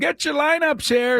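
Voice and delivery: high, far-off ballpark vendor call; stadium vendor hawking. Pretend. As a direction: bellow it like a hot dog vendor. Vendor calls after organ music.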